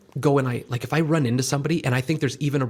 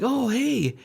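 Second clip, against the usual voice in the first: mock surprise; 11 semitones higher than usual. Greeting a stranger who knows him.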